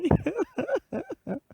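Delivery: high-pitched fake boo-hoo sobs; comic fox wailing; fox crying. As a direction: cry in quick, squeaky mock sobs. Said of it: "she started to cry".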